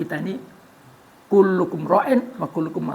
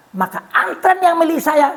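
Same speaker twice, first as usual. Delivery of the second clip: louder, higher, slowed announcement; declaring; 11 semitones higher, 8 dB louder, slower than usual. Acted. Umar's son explains to the congregation, loud. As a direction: proclaim it to the crowd.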